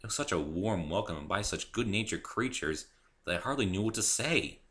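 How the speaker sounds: ordinary talk, nothing marked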